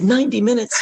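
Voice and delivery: astonished retold yelp; alarmed disbelief. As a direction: throw it back as a shocked question. Echoes length in alarm.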